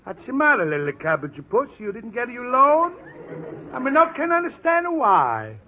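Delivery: put-on hearty, broad Italian accent; a jovial schemer in a thick Italian accent. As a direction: Pasquale greeting his friend in a big Italian accent. Pasquale's booming Italian voice.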